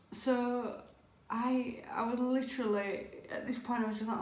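usual speaking voice

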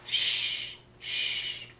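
very soft, drawn-out "shh"; hushing. Acted. Grandma hushes for sleeping bunnies, soft shushing. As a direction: hush gently, twice. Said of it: "abuelita dice".

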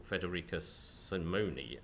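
usual speaking voice

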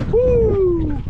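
loud, high whoop of joy; excited whoop. Real, not acted. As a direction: let out a big happy woo. Excited whoop after catching dinner.

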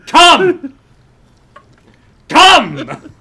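huge high shouts across a room; megaphone bark. Acted. Director ordering people across the room, megaphone bark. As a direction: bellow as if through a cheerleader megaphone. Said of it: "like, the cheerleader megaphone".

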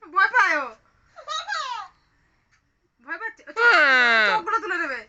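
high, loud, laughing mock threat; playful scary voice. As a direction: tease the toddler with a pretend scare. Playing scary tiger game with toddler. (মজা করে ভয় দেখানো)

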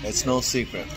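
ordinary talk

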